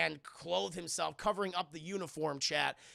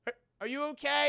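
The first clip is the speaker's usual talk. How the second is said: hurried asking; 6 semitones higher, 4 dB louder, faster than usual. Retold or imitated. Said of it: "And Ned's like".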